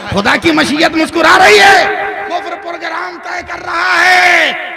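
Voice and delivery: fervent proclamation. Acted. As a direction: proclaim it to the crowd. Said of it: "प्यार से बोल दो". (जोश भरा ऐलान)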